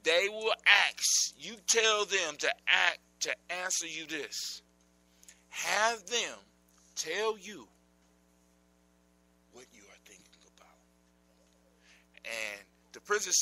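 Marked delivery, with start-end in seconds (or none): 0.0-10.8 lightly voiced old witch scheming; a hint of a sly witch. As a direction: muse it out like a crafty old hag. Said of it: "so the Neb says".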